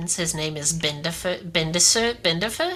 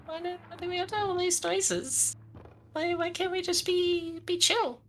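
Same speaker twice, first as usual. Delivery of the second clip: high, soft whiny plea; 11 semitones higher, 6 dB quieter than usual. Pretend. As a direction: whine like a scared kid. Whiny complaint about noises.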